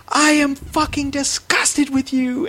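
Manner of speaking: imitating mom, high, slow and appalled; disgusted mom.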